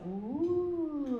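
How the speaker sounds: long, drawn-out cooing ooh; impressed audience ooh. Shown as a demonstration. Speaker shows the audience how to react, impressed ooh. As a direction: coo it like an impressed crowd. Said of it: "Everybody's supposed to go".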